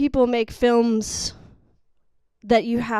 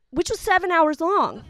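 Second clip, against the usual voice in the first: normal talking voice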